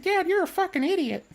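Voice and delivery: put-on high, fast childish complaint; mock a five-year-old whining at dad; little daughter voice. Streamer voicing a daughter complaining to her dad, high childish whine.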